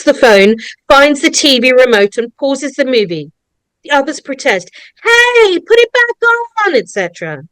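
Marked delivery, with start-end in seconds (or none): 5.0-6.7 hammed-up party kids objecting; protesting teens. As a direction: complain like rowdy kids. Party protests paused movie.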